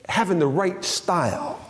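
ordinary talk, nothing marked

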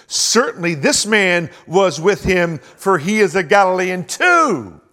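loud, slow, pointed charge; booming accusation. Acted. Bystander accuses Peter before the fire, loud and slow. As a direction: hurl the accusation slowly at him.

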